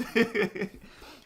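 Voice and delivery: high, loud burst of laughter. Real, not acted. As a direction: laugh out in surprise. Laugh at seeds.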